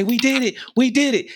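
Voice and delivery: high, triumphant mock cheer; excited cheer. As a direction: celebrate like you just made it. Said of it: "and she says".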